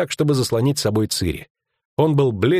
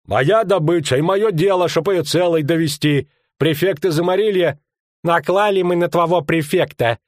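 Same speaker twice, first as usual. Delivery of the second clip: high-pitched haggling lout; arguing ruffians; 7 semitones higher than usual. Acted. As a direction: press the argument in a raised, insistent voice.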